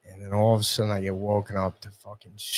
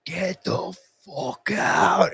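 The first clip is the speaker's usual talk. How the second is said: angry yell; 7 semitones higher, much slower than usual. Retold or imitated. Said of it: "he's like".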